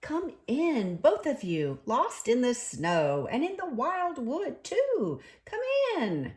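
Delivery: high friendly invitation; friendly welcome. A narrator's reading. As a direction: usher them in warmly. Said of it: "in a much friendlier voice".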